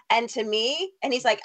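usual speaking voice

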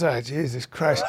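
low, slow, muted groaning aside; weary dismay. Retold or imitated. Imitating a comic fed up with club chatter, weary aside. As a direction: sink into soft, drawn-out exasperation.

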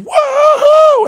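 high, loud whoop of an excited fan; screamed cheer. Retold or imitated. Dad imitating his son at the show, screamed cheer. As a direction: scream a woo-hoo from the audience. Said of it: "He screamed".

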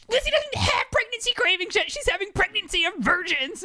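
very high, squeaky mock objection; exaggerated protest. Wife objects to husband's pregnancy cravings idea, squeaky mock protest. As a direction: retell it as a squealed, hammed-up protest.

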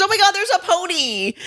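very high, delighted gasp; excited squeal. Retold or imitated. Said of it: "she goes".

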